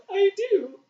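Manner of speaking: high, squeaky answer through laughter; barely getting it out for laughing. Real, not acted. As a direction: giggle the words out. Laughing answer to teasing question.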